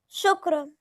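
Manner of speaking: small, awkward reply; flustered Lilian. A narrator's reading. Daughter accepts her mother's blessing, flustered. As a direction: answer with slight embarrassment. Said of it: "كانت ليليان بتتكلم بارتباك".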